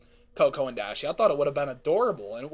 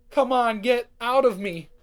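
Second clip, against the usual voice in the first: louder, pushing plea; lightly acted pain, urging the baby out; mock labor pain; 5 semitones higher, 4 dB louder than usual. A narrator's reading. Cadance urges her baby out of her, mock labor pain. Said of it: "Cadance pants from pain".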